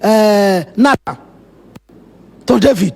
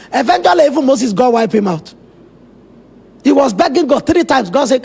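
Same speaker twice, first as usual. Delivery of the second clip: ordinary talk, nothing marked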